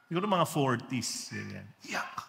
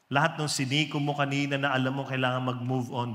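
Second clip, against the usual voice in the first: usual speaking voice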